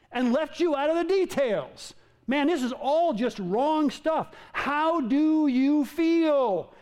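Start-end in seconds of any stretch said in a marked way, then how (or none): 4.5-6.7 drawn out slowly; slow question. Real, not acted. Preacher slowly asking the congregation how they feel, drawn out. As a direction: let each word hang. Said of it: "preacher asks congregation slowly".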